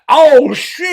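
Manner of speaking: loud, high mock exclamation; hammed-up shocked outburst; mock shout. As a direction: blurt it out like a shocked meme. Imitating meme reaction shout.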